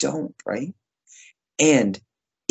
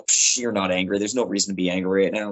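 dismissive brush-off; 6 semitones lower, faster than usual. Retold or imitated. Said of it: "they go".

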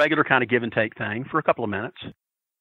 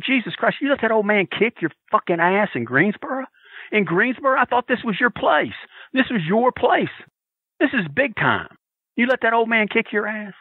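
higher, sarcastic heckling; mocking heckle; 8 semitones higher than usual. Fan heckling Magnum from ringside, sarcastic. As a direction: needle him with fake disbelief.

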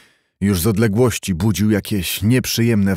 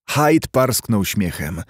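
ordinary delivery

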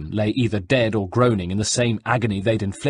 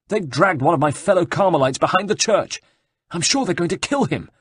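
frightened monk; 9 semitones higher than usual. Acted. Frightened monk tells a stranger about the soldiers, high and panicky. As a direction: rush it out like a scared friar.

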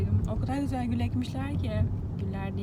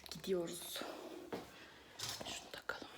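whispering; 3 semitones lower, 18 dB quieter than usual. Real, not acted. Whispering while filming quietly.

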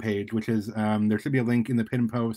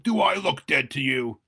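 shouting; 3 semitones higher, 4 dB louder than usual. Acted. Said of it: "appeared directly in front ... and shouted".

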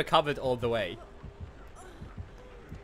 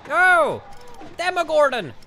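loud, high mock alarm; surprised shout; 11 semitones higher, 7 dB louder, slower than usual. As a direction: gasp out the killer's name.

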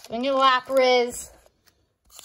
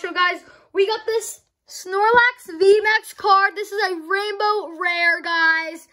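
excited announcement; 6 semitones higher, 4 dB louder than usual. Real, not acted. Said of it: "I'm just so excited right now".

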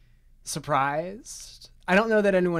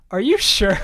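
loud, higher-pitched doubting question; skeptical mom; 7 semitones higher, 9 dB louder than usual. Retold or imitated. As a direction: imitate a mother pointedly questioning her kid's denial. Retold mom doubting her child's denial.

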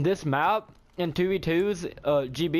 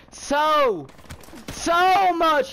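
excited shout; 13 semitones higher, 6 dB louder, much slower than usual. Real, not acted. Thrilled at getting a helicopter.

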